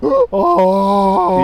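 gasping high donkey oh; loud imitated donkey bray. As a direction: suck in air then bray out oh. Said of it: "they go like".

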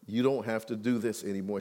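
low, soft and gentle; tender reassurance. Retold or imitated. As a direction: comfort her softly. Tender words to crying woman.

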